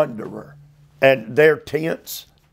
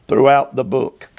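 ordinary delivery

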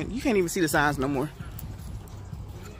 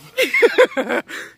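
high delighted laugh; 14 semitones higher, 6 dB louder than usual. Real, not acted. Laughing at her friend on the vlog, high delighted laugh.

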